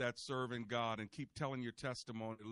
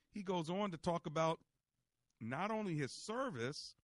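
preaching; 4 semitones higher than usual. Acted. Preaching about Paul's story.

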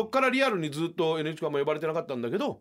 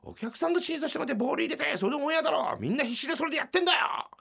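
furious producer. Retold or imitated. Producer scolding the comedians in the hallway, imitated fury. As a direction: tear into them like an angry boss. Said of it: "って言い出した時に".

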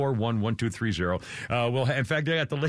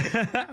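high laugh; 8 semitones higher than usual. Real, not acted. Laughs at the stewardess reminder.

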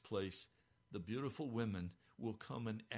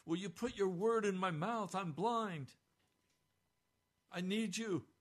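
louder, higher pleading prayer; crying out to the Lord; 6 semitones higher, 6 dB louder than usual. Retold or imitated. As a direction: call out to God, begging. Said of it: "I'm crying out to the Lord and saying".

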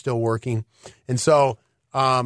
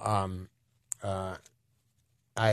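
ordinary talk, nothing marked

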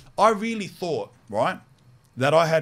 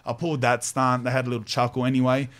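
ordinary talk

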